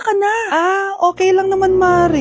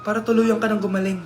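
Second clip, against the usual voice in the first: quiet sad revelation; 7 semitones lower, 5 dB quieter than usual. Acted. Sad revelation, father dead.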